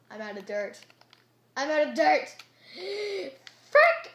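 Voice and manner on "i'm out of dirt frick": high, loud burst of panic; frustrated shout. Real, not acted. Gamer out of dirt, frustrated shout. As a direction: shout it higher, then a gasp and curse.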